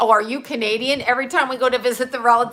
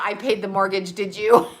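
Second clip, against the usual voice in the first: ordinary delivery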